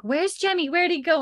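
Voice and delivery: high, worried questioning; acted panicky questions, pitched high; mock panic. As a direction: retell a mother starting to panic. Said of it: "starting to get panicky real fast".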